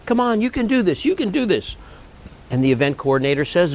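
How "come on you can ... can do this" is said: higher, urgent pleading to his son; lightly voiced father begging his boy; gentle push, mock-urgent; coaxing dad. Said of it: "he wasn't mean to the kid, but he goes".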